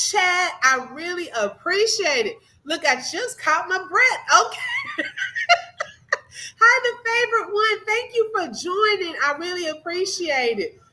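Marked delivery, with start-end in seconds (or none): 5.1-5.8 a squealing giggle; high squeal of laughter. Real, not acted. Streamer laughing again, high squealing giggle. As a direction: let the giggle run high. Laughing after catching breath.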